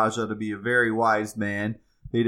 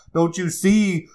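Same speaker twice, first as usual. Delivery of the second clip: higher, louder plea; pleading; 7 semitones higher, 5 dB louder than usual. A narrator's reading. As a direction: beg them to understand. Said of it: "pleaded the sixth man".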